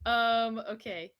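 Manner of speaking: ordinary talk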